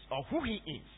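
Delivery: ordinary delivery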